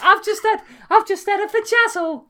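a very high, loud mock Essex voice; Essex accent. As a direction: squeal it like a camp Essex character.

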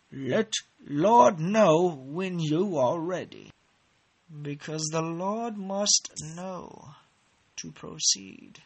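slow, drawn-out mock-solemn proclamation; grand, wavering, stretched-out pronouncement; mock preacher. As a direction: intone it like a hammy preacher. Mock-solemn summons to proceed.

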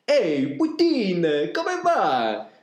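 high, loud, slow cheery hail to a friend; excited greeting. Acted. Narrator hails his friend Putin, high and excited. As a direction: call out to Putin like an old pal.

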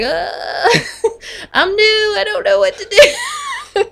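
high, laughing mock panic. New nurse laughing at her own panic, high and helpless. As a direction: squeak it out through laughter. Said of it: "laughs I was just like".

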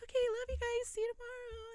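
high, sweet fan farewell; giddy goodbye. Retold or imitated. Retold giddy goodbye to group.

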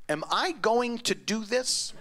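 drawn-out inner question; slow, tempted whisper of a thought; slow wondering thought. Retold or imitated. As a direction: stretch it out as you weigh it. Inner hesitation before the joke.

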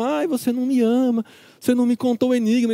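imitating a complaining bride; hammed-up nagging wife voice; whiny wife. Mocking the wife's whining.

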